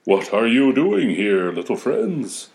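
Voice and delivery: put-on slow, kindly old man voice; a sleepy old Father Christmas; slow grandfatherly greeting.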